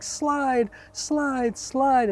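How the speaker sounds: high, drawn mock yell; high-pitched urgent repeats, like a restrained scream; retold father's scream. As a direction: imitate a frantic dad yelling instructions, volume held back. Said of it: "and he is screaming".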